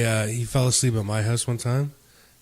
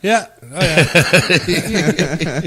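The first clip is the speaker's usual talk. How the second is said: laughing agreement; 3 semitones higher, 4 dB louder, much slower than usual. Retold or imitated. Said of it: "And I was like".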